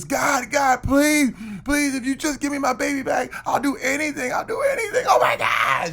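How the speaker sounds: high-pitched, hammed-up desperate mother; frantic pleading. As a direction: beg wildly, voice shooting up. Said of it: "she's freaking out".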